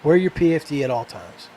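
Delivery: ordinary delivery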